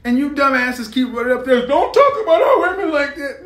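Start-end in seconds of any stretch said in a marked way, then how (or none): 1.6-3.5 high, loud imitation of outraged men; hammed-up protest, pitched way up; mock indignant defenders. Men running up to defend women, mock indignation.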